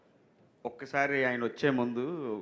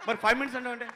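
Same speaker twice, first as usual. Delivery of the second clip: high-pitched, scolding question; challenging director; 10 semitones higher, 4 dB louder, much faster than usual. Retold or imitated. Director questions assistant about the promised five minutes, challenging. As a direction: imitate a boss demanding an explanation.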